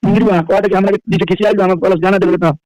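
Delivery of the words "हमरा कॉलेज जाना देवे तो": ordinary talk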